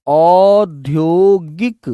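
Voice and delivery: औद्योगिक drawn out syllable by syllable, very slow; slow dictation. Shown as a demonstration. Teacher dictating औद्योगिक to pupils, very slow and clear. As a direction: spell out औद्योगिक slowly for the class. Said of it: "उद्योग में इक प्रत्यय लगा दीजिए".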